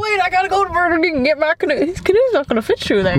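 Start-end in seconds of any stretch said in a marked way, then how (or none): 0.0-1.7 high, rushed mock urgency; hammed-up hurried man with a canoe; imitating passer-by. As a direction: blurt it in a silly high voice. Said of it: "He's like".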